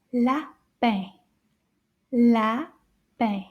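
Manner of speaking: LAPIN split into two slow syllables. Shown as a demonstration. Teacher modelling "lapin" for children, split by syllable. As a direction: say "lapin" with a gap between syllables.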